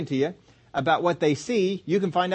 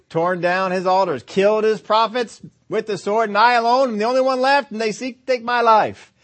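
builds higher and louder, pleading; a man practising his grievance, climbing in pitch; rehearsed complaint rising; 5 semitones higher, 4 dB louder than usual. Retold or imitated. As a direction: work yourself up as you rehearse it. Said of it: "he's probably saying to himself".

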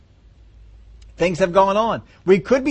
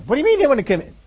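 surprised father; 8 semitones higher, much faster than usual. Retold or imitated.

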